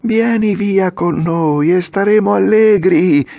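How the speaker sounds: put-on a few kids calling from the cart; four voices shouting. A narrator's reading. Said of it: "gridarono altre quattro voci". (gridato da quattro voci, alto e forte)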